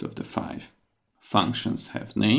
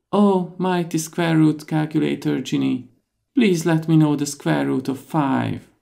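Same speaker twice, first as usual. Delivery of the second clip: higher, louder pleading plea; hero voice; 7 semitones higher, 5 dB louder than usual. Acted. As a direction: beg the mighty genie, raised up.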